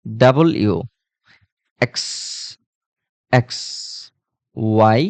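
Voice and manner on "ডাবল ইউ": ডাবল ইউ in two clear parts; corrected letter name. Shown as a demonstration. Teacher correcting the letter W for students. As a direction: say ডাবল then ইউ separately. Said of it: "কি বলতে হবে?".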